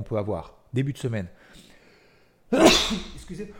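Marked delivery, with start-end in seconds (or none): 2.5-3.1 sudden loud sneeze mid-sentence; sneezing. Real, not acted. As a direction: let out one sharp sneeze. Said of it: "j'ai éternué".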